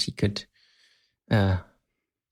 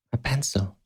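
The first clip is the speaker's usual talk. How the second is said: gasped, hushed mock disbelief; gasp, then softly question it in pretend horror; mock shock; 3 semitones higher, 4 dB quieter than usual. Co-host teasing about the pencil, mock shocked.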